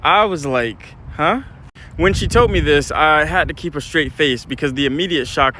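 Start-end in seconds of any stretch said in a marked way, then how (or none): 1.1-1.4 short rising, puzzled "huh?"; retold baffled reaction; confused huh. Reacts to shocking test news.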